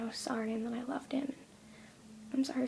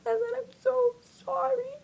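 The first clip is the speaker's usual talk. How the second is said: real sobbing, high and loud; crying; 12 semitones higher, 5 dB louder than usual. Real, not acted. Girl thankful and sorry about her family, crying hard. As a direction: talk through tears as the voice climbs.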